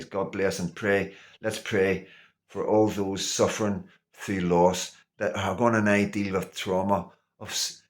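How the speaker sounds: soft, hushed blessing and prayer; quiet prayer. Acted. A vlogger closing with a hushed prayer for the grieving.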